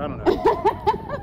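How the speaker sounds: laughing it off, slowed. Real, not acted. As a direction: chuckle through the words. Joking about destroying Cylons.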